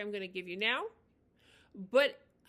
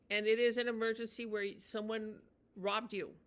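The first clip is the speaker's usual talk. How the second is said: usual speaking voice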